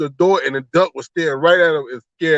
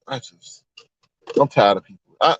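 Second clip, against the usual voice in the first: ordinary talk, nothing marked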